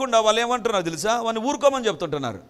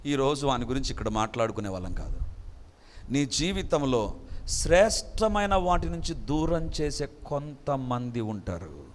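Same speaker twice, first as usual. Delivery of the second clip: ordinary talk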